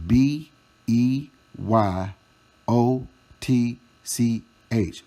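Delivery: letters spelled slowly one by one. Shown as a demonstration. Spelling B E Y O T C H slowly to taunt the grandpa. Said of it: "let me spell it this way".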